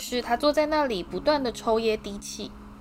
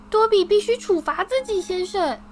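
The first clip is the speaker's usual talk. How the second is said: very high, louder elf voice bursting with honour; eager house-elf Dobby; 10 semitones higher, 5 dB louder than usual. A narrator's reading. Dobby thrilled to finally meet Harry, high squeaky voice. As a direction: squeak it out, overjoyed.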